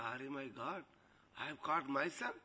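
acted soft, stunned realisation; quietly taken aback; hushed dismay. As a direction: drop the voice right down in disbelief. Lady discovers her own mistake.

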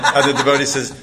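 plain reading voice

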